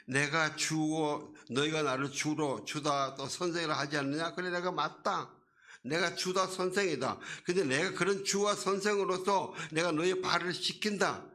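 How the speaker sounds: high, loud voice of Jesus at full preaching force. Acted. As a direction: proclaim it as Jesus to his disciples.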